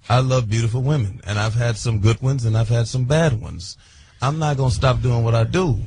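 singer voice. Real, not acted. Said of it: "in the singer voice right now".

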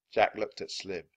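usual speaking voice

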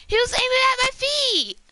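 high, loud, outraged shout; outraged disbelief. Real, not acted. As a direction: yell it in total disbelief. Outraged at getting killed.

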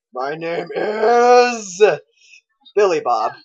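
slow, high, loud drawn-out reveal; hammed-up slow reveal; dramatic reveal voice. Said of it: "He's like".

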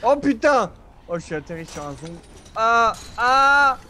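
high, loud startled cry; startled yell. Real, not acted. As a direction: jump out of your skin. Lands on a zombie.

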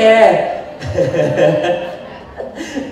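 long chuckle that tails off; amused laugh. Real, not acted. Teacher laughing before admitting he's kidding, amused. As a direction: keep chuckling at your own joke.

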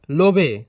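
"লোভে" read as spelt, lo-bhe; wrong pronunciation. Acted. Bluffing teacher dictates "লোভে" for love, mispronounced. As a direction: say "লোভে" for love, in two syllables. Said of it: "উচ্চারণ ভুলভাল বলছে".

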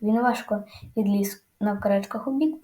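usual speaking voice

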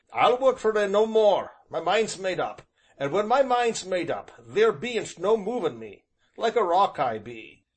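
loud, stubborn rustic vow; stubborn Job. A narrator's reading. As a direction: dig in your heels, loud and sure. Job's stubborn voice.

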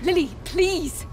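slow, high, pleading cry; drawn-out desperate plea; urgent plea. Acted. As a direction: beg her to do it now. Pleading partner to act.

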